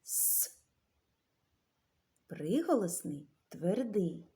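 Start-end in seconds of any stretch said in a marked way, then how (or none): 0.0-0.6 С as a soft, voiceless hiss; just a quiet hiss for С; isolated hiss. Shown as a demonstration. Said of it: "звуковий аналіз слова". (вчителька тихо шипить звук С)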